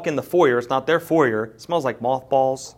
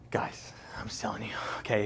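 near-whisper, much softer; hushed confiding; 3 semitones lower, 12 dB quieter than usual. Real, not acted. Preacher confiding to congregation about great pasta, hushed near-whisper. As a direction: drop to a whisper as if letting them in on it.

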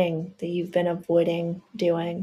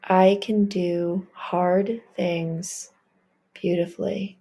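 very slow, voiced, each word drawn out; slow affirmation; slower than usual. Shown as a demonstration. Leading the listener in an affirmation, drawn-out voiced repeat. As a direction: stretch it out like a vow. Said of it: "Say it with me".

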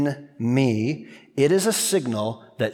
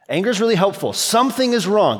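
emphatic; 7 semitones higher, 5 dB louder, faster than usual. Real, not acted. Preacher rising to full force.

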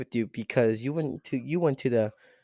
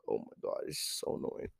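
soft, voiceless groaning aside; hushed exasperation; exasperated whisper; 12 dB quieter than usual. Real, not acted. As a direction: whisper it, fed up.

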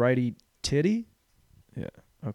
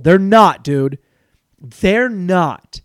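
louder, firm insistence; emphatic rant; 4 semitones higher, 9 dB louder, much slower than usual. Real, not acted. Comic ranting at the crowd about war movies, a loud, insistent rant. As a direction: insist like the argument is over.

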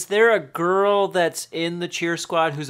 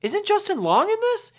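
much higher, insistent question; insistent repeat; 13 semitones higher than usual. Real, not acted. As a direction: ask again, pushing the point.

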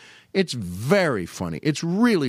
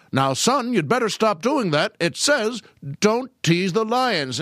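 lightly voiced, higher announcer warning; 7 semitones higher than usual. Announcer warns a boy at the zoo, lightly imitated. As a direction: admonish the boy, pitched up. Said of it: "and the announcer says".

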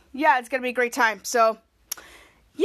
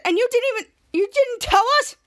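much higher, accusing; indignant Captain Man; 8 semitones higher, 3 dB louder than usual. Retold or imitated. Captain Man confronting Charlotte, indignant. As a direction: sound betrayed. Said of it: "Captain Man said".

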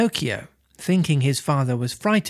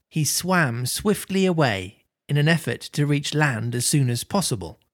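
usual speaking voice

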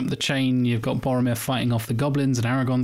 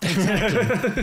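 laughing; 8 semitones higher, much slower than usual. Retold or imitated. Laughing voice of character.

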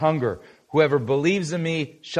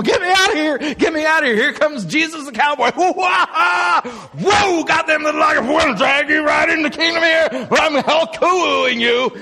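much higher, shouted, frantic bellowing; panicked rodeo cow; 12 semitones higher, 5 dB louder than usual. Pretend. Preacher plays a terrified rodeo cow fleeing the cowboy, shouting high and frantic. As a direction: yell like a cow bolting from the chute. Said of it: "Think of it like a rodeo".

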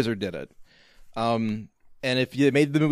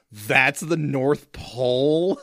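incredulous; much slower than usual. Retold or imitated. Scott questioning Charlie about the North Pole, mock incredulous. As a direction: stretch out NORTH POLE in retold disbelief. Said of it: "so amazingly incredulously, he just screams".

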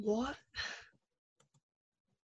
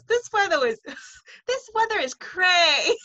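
nephew saying cray; 9 semitones higher, 4 dB louder than usual. Retold or imitated. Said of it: "and he goes".

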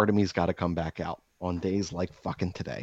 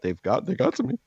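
admitting defeat; 11 semitones higher than usual. Retold or imitated. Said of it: "I'm like".

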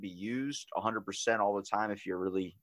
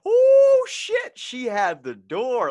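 long very high "oh", then slow; shocked outburst, retold; 14 semitones higher, 10 dB louder, much slower than usual. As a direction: replay the shock for laughs, soaring high.

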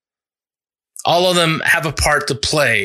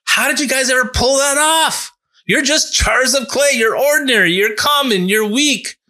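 amazed onlookers; 8 semitones higher than usual. Retold or imitated. Voicing amazed onlookers, pitched up.

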